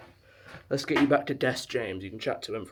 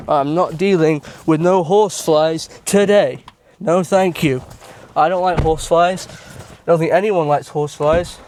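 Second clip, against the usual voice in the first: loud, raised-pitch real protest; much louder, higher, emphatic complaint; agitated refusal; 4 semitones higher, 12 dB louder than usual. Real, not acted. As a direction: refuse loudly, rattled by a horsefly. Horsefly landed on the hand.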